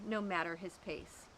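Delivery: plain reading voice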